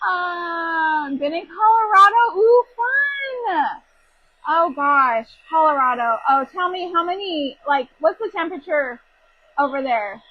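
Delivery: high, loud, delighted; light up at the news; excited reaction. Real, not acted. Host reacting to a viewer's Colorado trip, high and loud.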